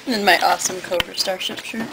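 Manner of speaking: hiccup in the middle; talking through hiccups. Real, not acted. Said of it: "Did I just hiccup in your ear?".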